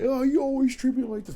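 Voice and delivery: high-pitched imitation of a complaining girlfriend. Imitating his online girlfriend accusing him, high mock complaint. Voices girlfriend in retold argument.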